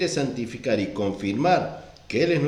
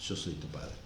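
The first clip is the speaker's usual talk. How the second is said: much lower, hushed deep voice; deep, quiet divine father voice; 6 semitones lower, 12 dB quieter than usual. Retold or imitated. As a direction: murmur it low like God himself. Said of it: "sino que le dijo".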